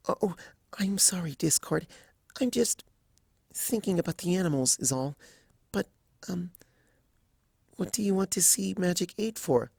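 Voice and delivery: soft, high timid mare voice; timid Fluttershy. Acted. Fluttershy apologising to Discord, soft and high. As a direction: apologise gently, barely voiced.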